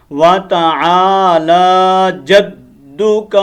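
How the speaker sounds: وَتَعَالَى slow, with a long ain and a long lam; correct recitation. Shown as a demonstration. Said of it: "یہ صحیح قرات ہے۔". (وَتَعَالَى کو عین اور لام لمبا کر کے پڑھیں)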